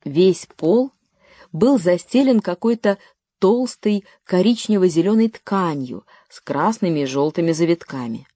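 plain reading voice

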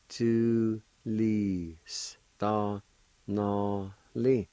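"Tu li sta na li" very slow, syllables separated; slow careful pronunciation. Shown as a demonstration. Teacher to learners, syllables spoken slowly apart. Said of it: "And it's pronounced like this".

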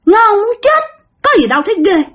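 a creepy crone smacking her lips; eerie old woman eating scabs, high and gleeful; relishing old woman. Retold or imitated. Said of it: "cái bả nói".

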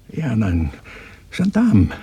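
high, loud, burst out on Gendarmen; scornful outburst. Acted. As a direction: fling the word out with scorn. (hoch und laut herausgeschleudert)

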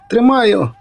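high, strained shout of effort; shouted reply. A narrator's reading. Poet straining at the line, shouting high. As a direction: yell it back while hauling the line. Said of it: "прокриктав Пет".

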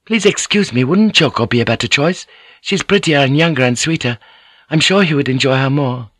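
put-on a young courtesan gently begging; pleading young girl. Young Kiku pleads with Gyoko.

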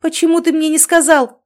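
high, loud indignant wail; yelling. A narrator's reading. Mother yells at son for hiding news, yelling. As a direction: cry out the reproach. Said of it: "снова завопила она".

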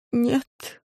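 soft, weak bird voice; quiet. A narrator's reading. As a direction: answer faintly, barely alive. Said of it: "ответила птица тихо".

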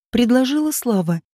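ordinary delivery, nothing marked

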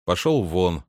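usual speaking voice

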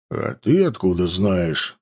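low, slow, wary question; suspicious question. A narrator's reading. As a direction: narrow your eyes on it. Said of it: "с подозрением спросил Накх". (с подозрением)

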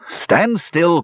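high raging cry; enraged hobgoblin. Acted. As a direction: cry it out in rage. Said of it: "cried the enraged hobgoblin".

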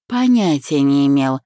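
ordinary delivery

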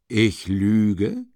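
mock indignation. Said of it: "sich scheinbar ereifernd".

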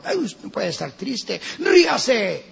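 sing-song mock cheer, high on ríase; mocking cheeriness. Preacher mocking a cheery church member, high sing-song. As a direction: tease the sad brother with fake cheer.

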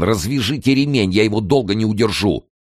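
high, urgent order under effort; strained hoarse plea. A narrator's reading. Kern holding the body up, hoarse with effort. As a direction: plead while straining under a weight. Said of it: "хрипел он".

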